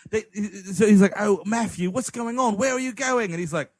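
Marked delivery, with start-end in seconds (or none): 1.2-3.3 exaggerated uber British, lifting on questions; chimney-sweep British impression; Johnny Lee Miller. Worried employee questioning his boss, mock British accent. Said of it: "uber British he's ever sounded".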